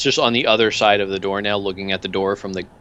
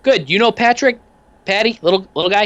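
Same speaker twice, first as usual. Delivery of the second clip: much higher, cheery odd little thug; much higher goofy henchman voice; Peanuts voice; 11 semitones higher, 4 dB louder than usual. Acted. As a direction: chirp the question brightly.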